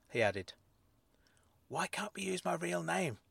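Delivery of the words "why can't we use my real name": breathy hushed whisper. A narrator's reading. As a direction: whisper it back urgently. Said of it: "I whispered back".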